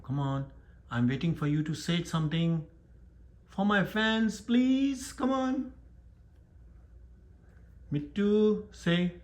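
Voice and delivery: high, wheedling plea to a pet; pleading with a pet. Real, not acted.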